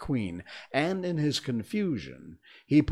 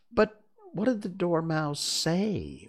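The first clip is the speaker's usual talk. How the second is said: high, slow query; drawn-out, high-pitched question; questioning juror; 8 semitones higher than usual. A narrator's reading. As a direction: ask slowly in a high juror's voice.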